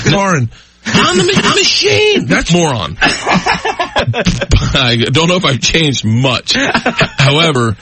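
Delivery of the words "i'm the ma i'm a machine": mock boast. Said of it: "say 'em out loud at a bar".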